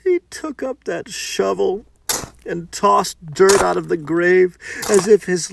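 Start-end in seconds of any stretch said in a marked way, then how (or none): none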